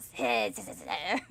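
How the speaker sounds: low hissing snake. Pretend. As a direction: hiss like a snake. Imitating snake hiss.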